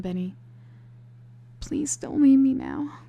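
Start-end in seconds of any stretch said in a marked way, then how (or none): 1.6-3.0 higher and louder, pleading; cling and beg him to stay; tearful plea. A narrator's reading. Heroine clings to Benny.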